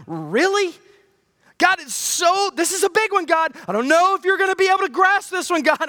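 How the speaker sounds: high, fast, hammed-up fretting; mock anxious pleading. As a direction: overdo the worrier. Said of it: "And God's like".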